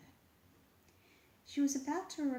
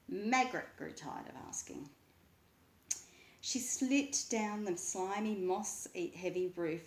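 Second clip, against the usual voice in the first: plain reading voice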